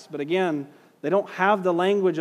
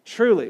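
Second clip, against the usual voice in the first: Truly said alone, higher and louder, falling; stress on truly; 4 semitones higher, 5 dB louder than usual. Shown as a demonstration. Teacher to class, answering his own question, stressing Truly. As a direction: hit the word Truly hard and let it drop. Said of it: "What word did he keep emphasizing?".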